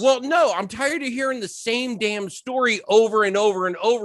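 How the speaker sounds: high-pitched, fed-up protest; exasperated rant. Real, not acted. Fan objecting to the same story, exasperated.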